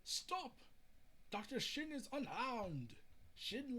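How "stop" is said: a high shouted stop; sharp command. Acted. Soldier halts the attack on Shin, high shout. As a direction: cut them off high.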